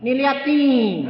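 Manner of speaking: high, loud, drawn-out retold cry; exasperated showing. Father showing child his swelling, loud complaint.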